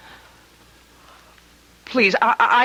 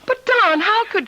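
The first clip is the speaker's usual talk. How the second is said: alarmed plea; 10 semitones higher than usual. Acted. Wife pleading in tense argument.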